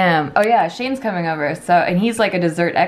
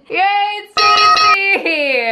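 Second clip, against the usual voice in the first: high, loud, slow cheer; excited; 10 semitones higher, 5 dB louder, much slower than usual. Real, not acted. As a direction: stretch out the delighted yay. Thrilled the dessert tastes good.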